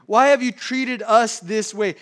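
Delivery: loud, reproachful mother's demand; accusing question. Acted. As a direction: voice Mary's hurt accusation, loud. Preacher voices Mary's accusing question.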